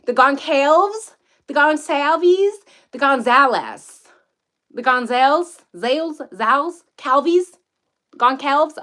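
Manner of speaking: slow teasing run of wrong takes on Goncalves; deliberate mispronunciations. Pretend. To chat, mangling the name to tease them. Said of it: "I should mispronounce it just to trigger you".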